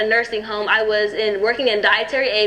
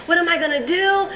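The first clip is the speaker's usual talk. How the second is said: high, panicky rush of worries; retold teen panic to her sister; scared; 7 semitones higher than usual. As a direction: spill the fears out in a panic. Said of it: "I was just scared".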